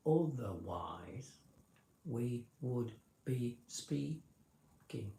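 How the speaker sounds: slow, every word set apart; slow deliberately separated words; unmerged slow speech. Shown as a demonstration. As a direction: speak word by word without linking, slowly. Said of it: "like that all the time".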